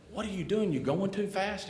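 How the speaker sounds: high, startled questions; high-pitched worried teen; alarmed question. Retold or imitated. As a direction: ask in sudden alarm. Panicked friend questioning driver.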